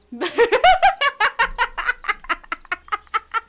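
high, loud peal of laughter; helpless laughter. Real, not acted. Player discovering her captive is dead, helpless laugh. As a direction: burst out laughing, long and rippling.

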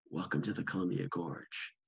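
mock-casual welcome, like a knowing insider; dry, quiet tip-off to a newcomer; nonchalant. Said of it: "kind of leaned over to me nonchalant".

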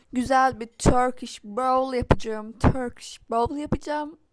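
loud, slow, showy announcement; excited announcement. Real, not acted. Vlogger announces her Turkish bowl to viewers, loud showy announcement. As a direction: announce it like a big reveal.

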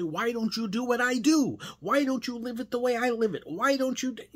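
high, rising exasperated questions building to a cut-off; frustrated demand. Retold or imitated. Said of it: "want to reach out and grab him and say".

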